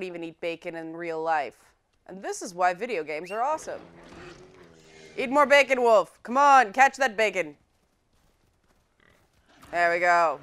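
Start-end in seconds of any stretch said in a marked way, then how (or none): 5.2-7.6 loud, high-pitched urging; calling out orders. Real, not acted. Player egging her wolf on after a boar, loud and high. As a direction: cheer on a pet in the chase.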